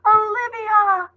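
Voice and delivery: high, long, loud cry of a name; calling scream. A narrator's reading. Mother calling her daughter from below, loud and desperate. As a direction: call out from far below, desperate. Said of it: "screamed her mother's voice".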